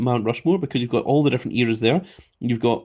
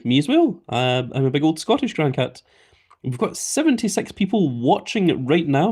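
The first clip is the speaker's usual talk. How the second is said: ordinary talk, nothing marked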